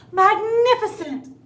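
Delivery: very loud, high, slow Magnificent; mock amazement. A narrator's reading. Princess gushing over the dragon, loud and high. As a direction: lay the praise on thick. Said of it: "said Elizabeth".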